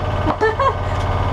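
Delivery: breathy relieved laugh. Real, not acted. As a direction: laugh out the tension. Done with the radio call.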